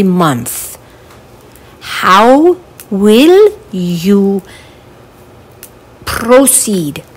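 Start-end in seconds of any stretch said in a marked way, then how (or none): none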